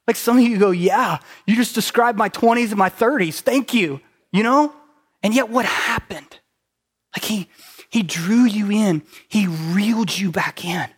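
loud, driving sermon delivery; high, loud pulpit voice; preaching at full force. Acted. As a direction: preach it hard to the room.

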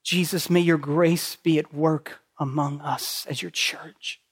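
hushed pastoral prayer. Acted. Pastor leads closing prayer.

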